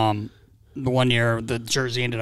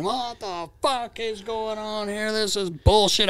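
high-pitched mock rant, drawn out at first; slow, high mock outrage; retold screaming; 7 semitones higher, slower than usual. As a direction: imitate an outraged player without really shouting. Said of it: "I'm getting screamed at by some dude".

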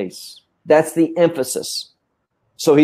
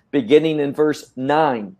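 usual speaking voice

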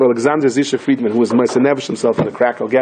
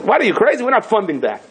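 incredulous objection; 8 semitones higher than usual. Acted. Congressman balks at a budget item.

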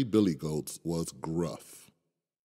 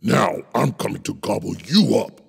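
louder, fast troll roar; 5 dB louder, faster than usual. Acted. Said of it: "roared the troll".

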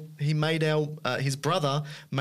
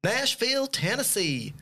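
Nashville, Tennessee said high in a put-on Southern accent; name the city like a local from Tennessee; a playful Southern American accent; 9 semitones higher than usual. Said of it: "I hope that my accent is correct".

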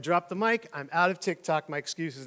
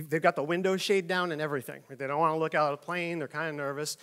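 ordinary talk

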